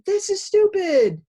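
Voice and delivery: high, loud, slow mock frustrated student; frustrated outburst. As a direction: blurt it out like a fed-up kid. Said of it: "they get really frustrated and confused and they say".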